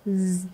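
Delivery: soft sustained buzz on "Z"; isolated Z sound. Shown as a demonstration. Teacher answering which sound is in design, buzzed Z. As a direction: say "Z" as a held zzz. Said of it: "âm Z".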